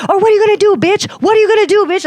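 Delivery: high-pitched re-enacted challenge; confrontational taunt. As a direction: get in her face, daring her. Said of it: "getting in her face".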